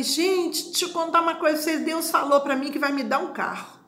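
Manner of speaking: high, fast, bursting with news; telling friends God promised her a car, high and fast; excited news. Retold or imitated. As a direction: blurt it out to the group, fast and high.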